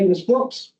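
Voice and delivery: ordinary delivery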